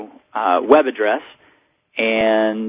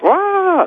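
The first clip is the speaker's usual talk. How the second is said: sudden high squeal of panic; mock freak-out yelp; 4 dB louder than usual. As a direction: squeal like a headless chicken. Chicken with head cut off.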